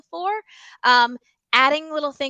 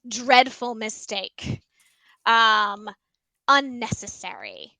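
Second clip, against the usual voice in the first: slowed right down, each word laid out; showcasing a vocabulary word, drawn out; slow vocabulary read; slower than usual. Shown as a demonstration. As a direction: savour the big words for the kids. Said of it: "really great vocabulary words like".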